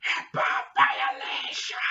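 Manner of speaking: hoarse, drawn-out whisper-scream; strained scream. Acted. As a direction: scream it slowly with no voice behind it. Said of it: "I was there screaming".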